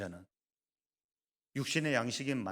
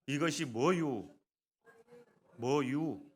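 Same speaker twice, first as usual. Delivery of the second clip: drawn-out Chungcheong dialect question; 4 semitones higher, 3 dB louder, much slower than usual. Shown as a demonstration. Pastor showing the congregation the verse in Chungcheong dialect, slow and drawn out. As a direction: stretch it like a Chungcheong local. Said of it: "충청도 사투리 어떻게 합니까".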